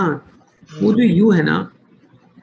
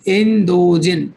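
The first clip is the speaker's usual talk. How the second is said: इंदोजिन said very slowly, syllable by syllable; slow model word; 3 dB louder, much slower than usual. Shown as a demonstration. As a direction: drag out इंदोजिन for the class. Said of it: "इंदोजिन है ना".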